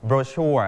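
"brochure" stressed on the second syllable, bro-SHURE; American stress on second syllable. Shown as a demonstration. Teacher shows students how Americans read it, "brochure" stressed on SHURE. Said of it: "เน้นที่ พยางค์ที่สอง".

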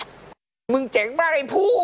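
high, slow gleeful cheer; self-praise. Retold or imitated. Father congratulates himself on staying calm, a high slow cheer. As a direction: crow to yourself in triumph, drawn out high. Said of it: "เราชนะอารมณ์ตัวเอง".

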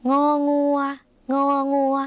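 งองัว said high and clearly; name the letter งองัว like a teacher; letter name read out. Shown as a demonstration. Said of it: "ไปต่อค่ะ".

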